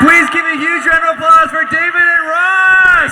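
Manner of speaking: high, loud shouted send-off; shouting to the crowd. Real, not acted. Host calling for applause for the guests, high full shout. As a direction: shout it out to rouse the whole hall.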